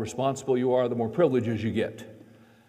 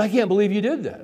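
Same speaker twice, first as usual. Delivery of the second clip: put-on higher, louder incredulous teen voice; son's disbelief; 8 semitones higher, 5 dB louder than usual. Son protesting to his dad, incredulous. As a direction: play the stunned son. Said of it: "He said".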